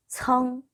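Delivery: "청" high and level; pronunciation demo. Shown as a demonstration. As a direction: hold "청" on one high, even note. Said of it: "청 이렇게 읽으시면 됩니다". ("청"을 높고 평평하게 읽는 발음 시범)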